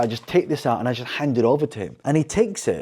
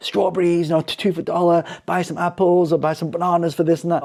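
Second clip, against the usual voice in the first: vendor calling out; 6 semitones higher than usual. Retold or imitated. The retold vendor carries on calling out deals, mock holler. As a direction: keep calling out the deals like a stall holder. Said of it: "he's just carrying on again".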